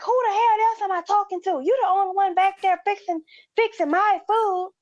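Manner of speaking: high mock rant; imitated angry customer. Customer ranting at the cashier about his food, mock anger. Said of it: "He went to the moon".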